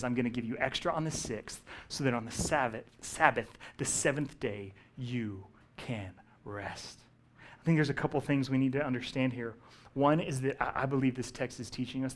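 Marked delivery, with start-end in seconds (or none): 0.0-7.0 lightly voiced God fading to a hush; gentle God voice. God promising rest to his people, soft and tender.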